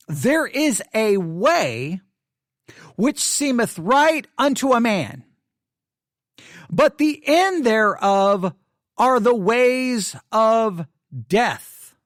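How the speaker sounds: slow, weighty reading with long pauses; solemn scripture reading. Shown as a demonstration. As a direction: draw each phrase out like a sacred text. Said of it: "Let me read the verse again".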